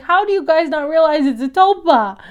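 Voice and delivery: exasperated scolding. Retold or imitated. Speaker scolding characters.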